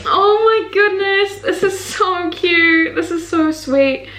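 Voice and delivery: high, slow, cooing gush; touched cooing. Real, not acted. Reading a sweet note from a Twitch friend, a high slow coo. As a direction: coo over something adorable.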